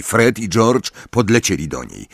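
usual speaking voice

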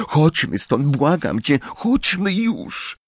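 put-on very high, pleading girl's voice; frightened pleading. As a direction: beg him to leave, scared.

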